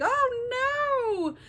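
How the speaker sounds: high, startled "oh no"; panicked cry. Retold or imitated. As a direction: yelp it in sudden panic. Storm hits Odysseus.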